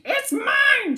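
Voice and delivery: high, loud squirrel shout. Acted. Cyril shouting at Bruce over the cone. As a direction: yell it like a claim. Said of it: "shouted Cyril".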